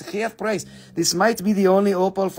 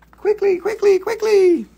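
high, loud squeak of quicklys; squeaky hurry-up; 12 semitones higher, 4 dB louder than usual. Acted. Seller urges viewers to buy now in a high squeak. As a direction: chirp it up high to rush them.

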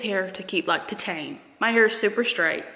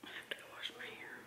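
whispering; 3 semitones lower, 29 dB quieter, faster than usual. Real, not acted. Whispered grumble to camera.

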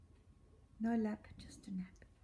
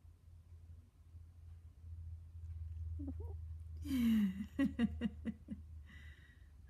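whispered laugh. Real, not acted. Laughing at pet.

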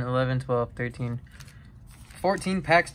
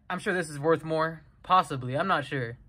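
ordinary delivery

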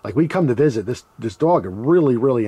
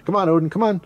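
high mock yell at the dog; mock calling the dog; 8 semitones higher than usual. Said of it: "a normal person would probably be yelling at the dog".

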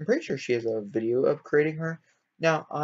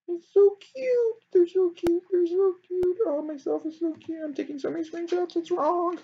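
much higher, louder squeal of delight; squeaky high voice; 4 dB louder than usual. Real, not acted. Squealing at how cute her sims look together. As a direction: squeal it out like a fangirl. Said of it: "My voice is getting higher".

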